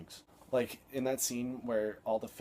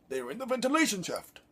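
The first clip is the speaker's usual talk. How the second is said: high, loud mock Neimoidian accent; mocking a Star Wars alien accent; mocking Viceroy accent; 12 semitones higher, 6 dB louder than usual. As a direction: parody the alien villain accent. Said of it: "it's just a stupid accent".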